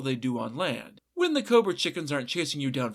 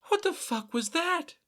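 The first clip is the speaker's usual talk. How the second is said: high, startled yelp of a question; jumping at the sudden bagel, pitched way up; startled squeak; 13 semitones higher than usual. A narrator's reading. Wife startled by bread landing in her lap, high shriek.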